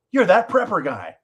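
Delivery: high, loud burst of recognition; excited recognition. Retold or imitated. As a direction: imitate a fan spotting someone they know. Acting out excited stranger recognizing him.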